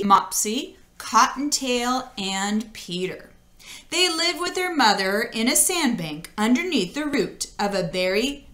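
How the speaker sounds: ordinary delivery, nothing marked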